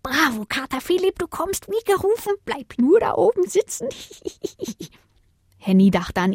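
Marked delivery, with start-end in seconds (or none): 0.0-3.9 1 hämischer Strauch. Acted. Strauch freut sich hämisch. 4.2-4.9 1 hohes Kichern. Acted. Strauch lacht hämisch.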